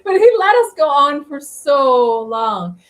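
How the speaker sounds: high, loud, laughing through the words; laughing exasperation. Real, not acted. Traveller telling how the seller let them go on, laughing exasperation. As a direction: squeal it through real laughter, stretching the end. Laughing at their own embarrassing story.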